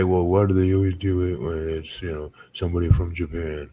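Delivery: lightly voiced social justice warrior objection; a hint of a put-on activist voice. Podcaster mimicking a critic of the green mist, light social justice warrior voice. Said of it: "was that your social justice warrior voice".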